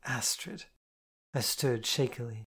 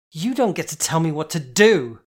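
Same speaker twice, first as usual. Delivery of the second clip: shouted in a rage, loud; furious shout; 5 semitones higher, 13 dB louder than usual. A narrator's reading. Roommate yelling at narrator in a rage. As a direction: spit it at her, furious. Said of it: "spat it at me".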